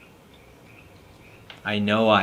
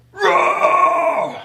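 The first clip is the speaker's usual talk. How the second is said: screamed roar, much higher and louder; monster roar; 6 dB louder than usual. Acted. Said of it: "We're getting there".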